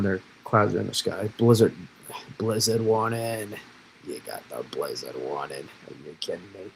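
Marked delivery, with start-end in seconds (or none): 1.4-6.7 lightly hammed-up Boston accent; a quick Boston accent joke. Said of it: "revert to a Boston accent".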